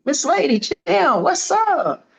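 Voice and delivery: high and loud, playfully incredulous; excited exclamation. Retold or imitated. Boxer reacting to a rival's taunt with loud excitement. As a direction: call her out, half laughing, wide-eyed.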